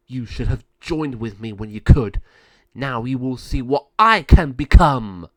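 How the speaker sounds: loud villain threat, building; menacing threat. Acted. Villain warning the girl, loud and menacing. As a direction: threaten with rising force.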